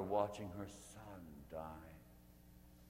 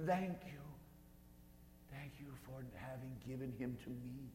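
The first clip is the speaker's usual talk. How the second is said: a lightly voiced mother praying, grief held in; a hint of a mother giving her son back; Mary's grateful prayer; 7 semitones higher, 3 dB louder than usual. Mary prays at the cross.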